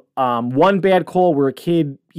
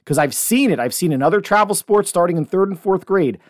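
ordinary talk

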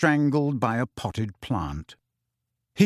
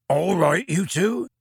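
Hagrid voiced; 5 semitones higher, slower than usual. A narrator's reading.

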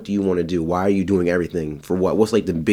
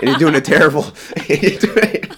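laughing; 7 semitones higher, 5 dB louder than usual. Real, not acted. Teasing friend, cracking up.

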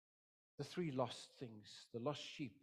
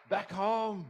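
loud, high exultant cry; jubilant shout; 8 semitones higher, 16 dB louder than usual. Acted. Preacher celebrating those coming back, shouting with joy.

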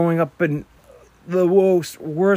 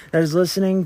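ordinary talk

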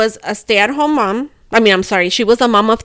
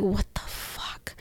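breathy stunned whisper, slow; 17 dB quieter, much slower than usual. Retold or imitated. Telling a friend, whispering her shocked reaction. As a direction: mouth it under your breath in disbelief.

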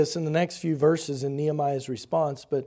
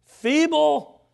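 scoffing, high and louder; mocking; 10 semitones higher, 6 dB louder than usual. Preacher mimicking the mocker, high scornful. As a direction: spit out the word feeble with contempt. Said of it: "Oh, they're so weak".

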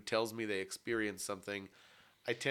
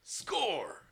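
high, gleeful cheer; victory cheer; 7 semitones higher than usual. Real, not acted. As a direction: crow it like a win. Celebrating winning the argument.

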